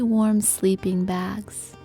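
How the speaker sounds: ordinary delivery, nothing marked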